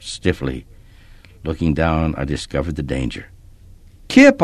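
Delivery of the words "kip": sudden high loud call. A narrator's reading. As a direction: shout his name in alarm. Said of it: "I called out".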